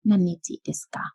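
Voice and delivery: "นันนิจิ" begins with the syllable nan; "นันนิจิ" read with nan. Shown as a demonstration. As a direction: say nan before nichi in "นันนิจิ". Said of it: "เขาก็ออกเสียงว่า".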